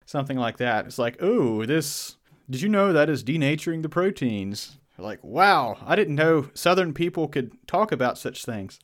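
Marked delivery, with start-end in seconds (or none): 1.2-4.8 mock show-off. Acting out showing off chemistry knowledge. 5.2-8.8 louder, higher wow of astonishment; mock amazement. Imagined listener hearing a Southerner talk chemistry, mock amazement.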